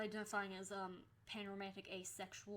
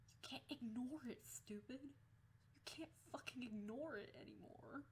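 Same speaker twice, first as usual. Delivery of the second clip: higher, soft scolding of oneself; exasperated inner voice, higher and hushed; retold self-scolding; 4 semitones higher, 5 dB quieter than usual. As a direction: plead with yourself under your breath.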